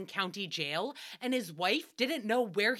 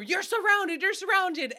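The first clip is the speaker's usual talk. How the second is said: police shout; 8 semitones higher, 4 dB louder than usual. Retold or imitated. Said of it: "all the police bust through".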